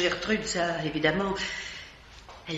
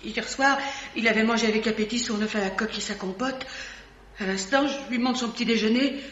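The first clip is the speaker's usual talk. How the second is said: ordinary delivery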